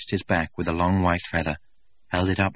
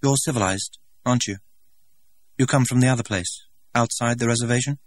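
put-on a lightly higher, curious young man; eager young stranger; 6 semitones higher than usual. A narrator's reading. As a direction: ask with wide-eyed eagerness. Stranger voiced by narrator.

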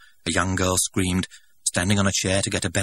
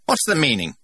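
higher and louder, affronted; outraged director; 9 semitones higher, 5 dB louder than usual. A narrator's reading. Director confronting Linda before the crowd, outraged. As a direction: demand an answer, stiffly. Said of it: "began the director".

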